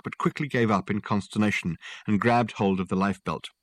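usual speaking voice

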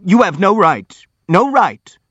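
high, loud shout of protest. A narrator's reading. Burdovsky protests at the prince's words, shouting. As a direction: shout it out, insistently. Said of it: "shouted Burdovsky".

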